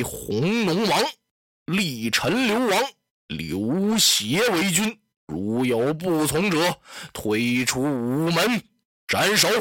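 slow, heavy decree ending in a sharp order; tyrant's decree. Acted. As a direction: drag out each word, then snap the sentence to execute. Dong Zhuo announces deposing emperor.

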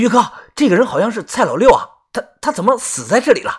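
fast, high panicked stammer; frightened stammer. A narrator's reading. Man finding a corpse, stammering fast in fright. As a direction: blurt it out in fright.

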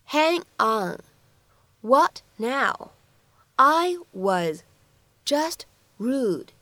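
slow, careful model reading with pauses; slow word-by-word reading. Shown as a demonstration. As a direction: break it into short chunks for learners. (一词一顿地慢速示范朗读)

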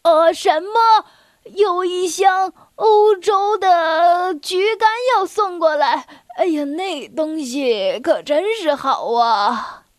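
put-on loud, slow deaf old bear; loud old bear mishearing cheerfully; old bear speaking loudly. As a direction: boom it out like he can't hear himself. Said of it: "老熊大声说".

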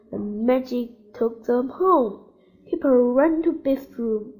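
ordinary delivery, nothing marked

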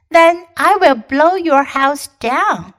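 loud, slow big bad wolf. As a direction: threaten like the wolf. Wolf threatening.

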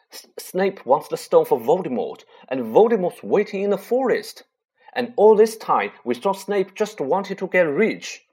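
high, loud agitated rush. Acted. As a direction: pace and blurt it out shaken. Agitated Harry pacing, still shaking.

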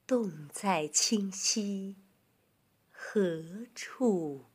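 very slow, measured recital; poetry recitation. Shown as a demonstration. Teacher reciting a Tang poem for children, slow recitation. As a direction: stretch each phrase with long pauses.

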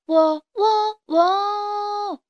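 high, sustained cock-a-doodle crow; rooster crowing. A narrator's reading. Little rooster posing on the haystack as singer, zestful crowing. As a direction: crow long notes high up. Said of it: "卡梅利多越唱越带劲".

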